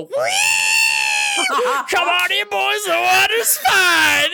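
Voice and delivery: high, drawn-out party holler; Muscle Man scream. Retold or imitated. Naked man rallying the whole party from the pool, Muscle Man scream. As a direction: scream it like a wild cartoon hero, stretching the words. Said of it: "I scream like Muscle Man".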